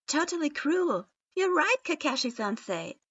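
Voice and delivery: high, loud outburst; loud yell. A narrator's reading. Naruto yelling furiously on Sasuke's behalf, high and loud. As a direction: shout it out in outrage. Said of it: "Naruto yelled loudly".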